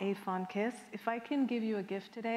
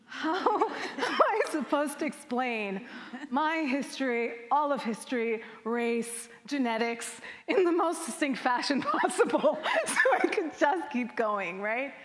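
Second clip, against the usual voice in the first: louder, laughing through the words; laughing exasperation; 4 semitones higher, 4 dB louder than usual. Real, not acted. Speaker to audience on explaining history at Target, laughing exasperation. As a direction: talk through real laughter, incredulous at the task. Absurd task at a checkout, laughing.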